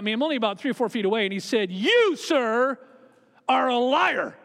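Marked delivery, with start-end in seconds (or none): 1.7-4.3 mildly angry retold accusation, a touch louder. Doctor accusing the pastor, mock furious. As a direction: jab the words at him like a pointed finger. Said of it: "stood up with great anger".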